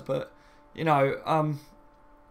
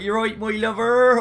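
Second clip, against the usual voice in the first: drawn-out, loud, higher-pitched Cornwall accent; 6 semitones higher, 7 dB louder than usual. Shown as a demonstration. Streamer trying out an old accent for viewers, hammed-up Cornwall accent. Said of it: "like, a Cornwall accent".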